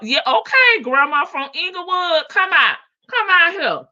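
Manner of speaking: yelling come out here, high and loud; loud calling out. Retold or imitated. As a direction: call them out of the house. Grandma calling someone out.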